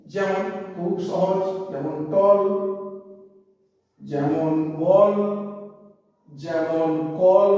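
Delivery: teacher slowly modelling টল, বল, কল; slow low demo words. Shown as a demonstration. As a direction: stretch টল, বল and কল very slowly to show the অ sound. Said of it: "এখানে প্রত্যেকটা ক্ষেত্রে এ উচ্চারণ কিন্তু অ".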